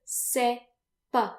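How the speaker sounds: teacher demo, slowly; slow, careful model. Shown as a demonstration. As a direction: say "Je ne sais pas" slowly with silent final S. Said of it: "then you want to say".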